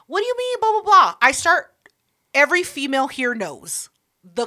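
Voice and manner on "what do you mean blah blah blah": much higher, sweet flirting act; mock flirty voice.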